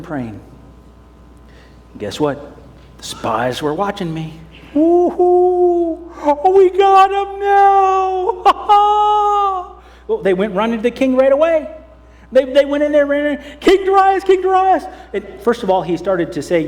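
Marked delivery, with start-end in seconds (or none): 4.7-9.7 high, loud, slow gleeful crowing; gloating spies. Acted. Spies gloat catching Daniel. 13.6-14.8 high, loud urgent cry; urgent calling. Acted. Spies rush in calling.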